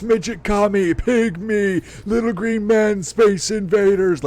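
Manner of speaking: high, loud NPC shouting; mock screaming. Player mimicking the same guy screaming different things, mock screaming. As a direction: scream it like a repetitive game character. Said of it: "just keeps screaming at you".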